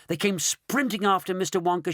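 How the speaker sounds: ordinary delivery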